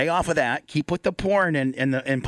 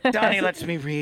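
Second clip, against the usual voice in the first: put-on Afghani accent; 4 semitones higher than usual. Afghan girl thanking Donnie for reading lessons, in mock Afghan accent. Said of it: "was that your Afghani accent".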